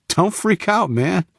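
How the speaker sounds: put-on high, easy American accent. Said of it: "in a perfect American accent".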